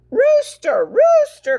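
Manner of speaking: high loud call across the yard. Acted. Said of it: "called Hen".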